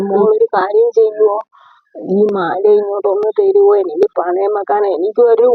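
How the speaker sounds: high, coaxing plea; pleading. Acted. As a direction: ask sweetly for the favour. Neighbour begs to borrow necklace.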